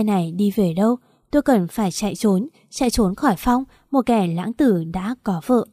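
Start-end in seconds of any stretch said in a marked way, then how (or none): none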